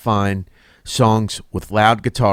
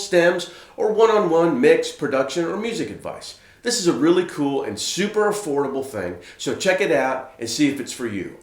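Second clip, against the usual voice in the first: higher, lilting channel-plug delivery; presenter's pitched-up promo read; scripted promo read; 5 semitones higher, 3 dB quieter than usual. Acted. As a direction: sell it like a presenter, dropping at phrase ends.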